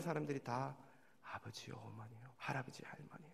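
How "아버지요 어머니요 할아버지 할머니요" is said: soft whisper, trailing off; tender whisper. Real, not acted. Pastor seeing elders as family, tender whisper. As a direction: breathe each name fondly.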